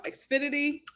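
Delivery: ordinary talk, nothing marked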